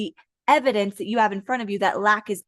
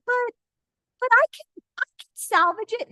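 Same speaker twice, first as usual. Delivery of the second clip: high, halting put-on voice; mock pleading protest; 11 semitones higher than usual. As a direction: imitate someone protesting weakly, pitched way up. Voicing listener clinging on.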